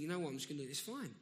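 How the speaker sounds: ordinary delivery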